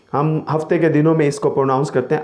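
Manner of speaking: usual speaking voice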